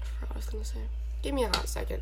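half-whispered to herself; muttered. Real, not acted. Losing her train of thought, mumbled aside. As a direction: mutter while searching for the thought. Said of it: "lost thought".

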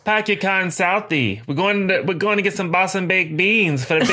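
over-the-top Southie impression of Boston; fake Boston accent. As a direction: do a badly faked Boston accent. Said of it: "not from Boston is like".

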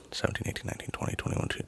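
soft, voiceless counting to himself; counting under breath. Real, not acted. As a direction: mutter the numbers quietly. Counting days under his breath.

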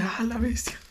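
breathless awed whisper. Retold or imitated. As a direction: whisper it as if stunned by the scene. Awed reaction to English version.

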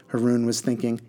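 plain reading voice

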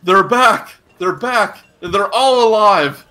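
high, loud yell of joyful news; excited yell. Acted. Civilian spotting the returning heroes, excited yell. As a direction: yell it out to the whole town. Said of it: "they just yell".

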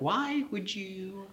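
high, slow retold question; mock disbelief. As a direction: imitate relatives asking, slow and high. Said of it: "They were like".

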